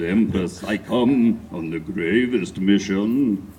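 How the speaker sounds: low, slow, far solemn voice; intoning gravely. Acted. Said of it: "I intoned".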